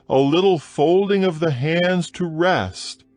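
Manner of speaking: ordinary delivery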